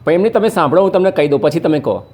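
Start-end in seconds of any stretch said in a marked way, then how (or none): none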